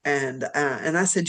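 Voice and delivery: normal talking voice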